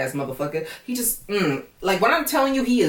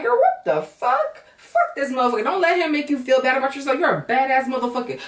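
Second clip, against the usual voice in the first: hyped-up friend pep talk; 8 semitones higher than usual. Acted. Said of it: "I would have reacted the same".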